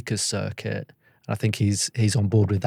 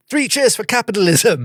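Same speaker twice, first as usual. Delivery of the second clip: higher, louder mock toff cheer; mock posh toff; 11 semitones higher, 6 dB louder than usual. Mocking the think tank's founding principles with a posh cheer. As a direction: ham up a plummy toast to capitalism.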